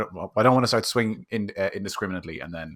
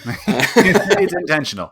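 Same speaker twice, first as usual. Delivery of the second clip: said through laughter, slow; laughing; 10 semitones higher, 10 dB louder, much slower than usual. Real, not acted. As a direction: laugh while saying it. Joke about pixelated budget.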